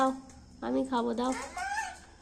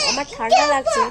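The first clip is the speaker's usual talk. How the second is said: mock complaint; 5 dB louder than usual. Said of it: "ও চাপা চাপা করে সব কাঁথাটা পুরো খুলে দিল।".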